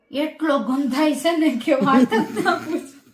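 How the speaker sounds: laughing through the punchline. Acted. Cheeky student jokes back to the guru, laughing. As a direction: giggle while saying it. Pappu cracks joke, laughing.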